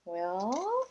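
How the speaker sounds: ordinary delivery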